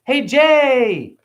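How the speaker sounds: put-on high, bright child's greeting; little girl greeting. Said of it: "she goes".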